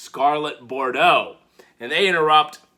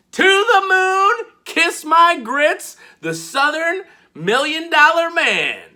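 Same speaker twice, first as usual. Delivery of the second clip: high, slow hollered catchphrase; drawn-out high hype call; 12 semitones higher, 4 dB louder, slower than usual. Retold or imitated. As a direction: belt it like a wrestler's entrance. Cameron Grimes catchphrase entrance.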